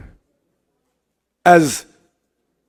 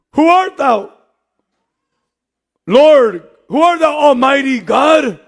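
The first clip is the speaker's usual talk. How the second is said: shouting Saul's question; 7 semitones higher, slower than usual. Acted.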